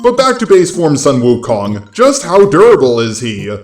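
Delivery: ordinary talk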